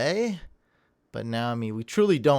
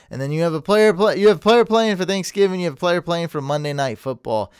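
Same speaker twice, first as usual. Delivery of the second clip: excited rant; 5 semitones higher, 6 dB louder, faster than usual. Real, not acted. Ranting about the tough question.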